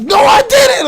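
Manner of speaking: high, very loud outburst; shouted, indignant denial; losing composure. Retold or imitated. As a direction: explode in protest. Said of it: "I was gonna lose my composure".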